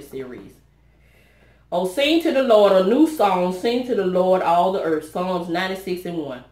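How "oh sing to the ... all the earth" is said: louder, proclaiming the psalm; fervent verse reading. Real, not acted. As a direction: declaim the verse with joy.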